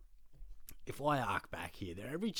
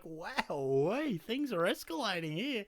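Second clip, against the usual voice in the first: very high-pitched, drawn-out disbelief; hammed-up reaction to a row blowing up; mock alarm; 11 semitones higher, 3 dB louder, slower than usual. Storyteller reacting as things escalate with rival fans, mock alarmed.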